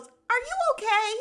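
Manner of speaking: high-pitched, loud imitation of disbelief; mock incredulous question. Castmate turns on the woman chiming in, mock incredulous. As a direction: squeal the question as a mocking jab.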